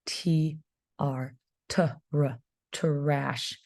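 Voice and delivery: "T", "R", "t", "r" split apart, then blended into "trash"; teacher blending sounds for first graders; sounding out. Shown as a demonstration. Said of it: "It starts with a T".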